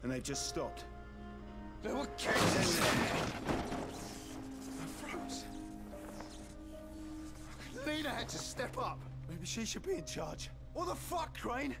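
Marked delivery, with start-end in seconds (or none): 1.8-3.2 upset outburst. Acted. Distraught over the lost floor. 10.7-11.7 exasperated shout. Acted. Shouting at Crane in dismay.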